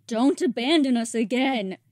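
urgent begging; pleading. Acted. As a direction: cry out to stop him leaving. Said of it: "She pleads".

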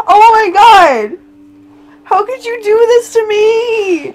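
drawn-out dramatic wail; hammed-up anguished lament; mock despair. Player blaming partner for death, mock despair. Player's game character has died.

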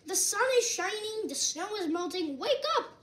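high, eager wake-up call; lively storybook calling; crying out. A narrator's reading. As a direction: announce it brightly, then pitch up to rouse the sleeper. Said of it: "cried Frog".

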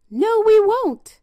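high, loud pig refusal; pigs refusing. A narrator's reading. Three pigs refusing the wolf, high loud pig voices. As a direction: squeal it back, defiant.

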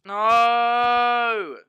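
real despairing yell; anguished shout. Real, not acted. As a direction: cry out as everything is lost. Dying in the game.

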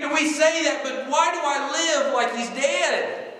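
high, loud preaching at full force. Real, not acted. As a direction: build into a high shouted proclamation.

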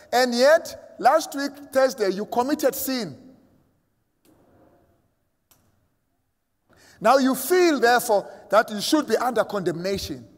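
fervent sermon climax; rising preaching. Acted. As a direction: press the point harder to the congregation. Preaching builds to full force.

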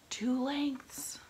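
high, loud call from the mast; lookout call. A narrator's reading. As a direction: shout the range down. Lookout calls distance.

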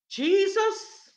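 loud, high cry of JESUS; calling out. Acted. Blind man calling to Jesus over the crowd, loud cry.

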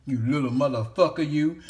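usual speaking voice

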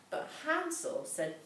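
ordinary delivery

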